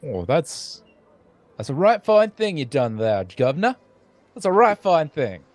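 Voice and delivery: muddled Southern-Cockney florist; grateful, in a mixed Southern and Cockney accent. Acted. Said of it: "like a Southerner with Cockney vocabulary".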